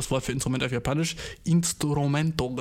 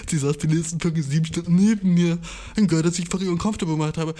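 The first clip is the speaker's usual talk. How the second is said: louder, laughing exasperated complaint; mortified laughing rant; 3 semitones higher, 5 dB louder than usual. Real, not acted. He recalls sitting beside her seven more hours, loud and laughing.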